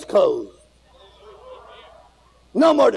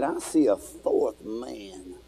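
awed hush; 6 semitones lower, 9 dB quieter, much slower than usual. Acted. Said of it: "He said".

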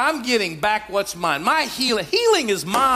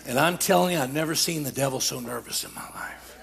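lower, subdued preaching voice; low confiding aside; 9 semitones lower, 5 dB quieter than usual. Real, not acted. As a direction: drop low and confide in the congregation.

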